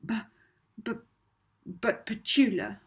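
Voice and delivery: anxious stammer; stammering in fear; stuttering. A narrator's reading. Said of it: "Molly stuttered".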